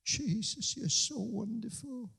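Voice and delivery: tender worship. Pretend. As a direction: linger over each word. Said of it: "sit down in His presence and say".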